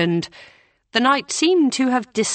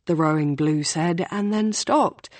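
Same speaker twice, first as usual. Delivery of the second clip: plain reading voice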